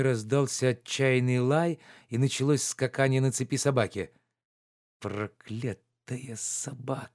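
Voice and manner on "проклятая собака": slow, soft growl through teeth; grinding teeth. A narrator's reading. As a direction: grind it out at the barking dog. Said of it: "проскрежетал зубами Обломов".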